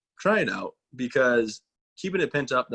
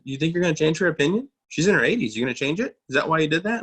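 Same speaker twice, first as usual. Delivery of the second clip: retold telling-off, raised pitch; scolding dad; 6 semitones higher, 4 dB louder, faster than usual. Dad scolding his grown child over a comment, mild mock telling-off. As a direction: pepper them with reproachful questions, lightly imitated. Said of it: "I got scolded by my dad".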